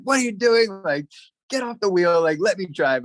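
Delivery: high, urgent demand from an upset brother; a retold panicky order to hand over the wheel; mock frantic brother; agitated brother.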